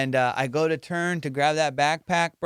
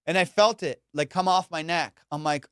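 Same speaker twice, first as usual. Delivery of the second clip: ordinary talk, nothing marked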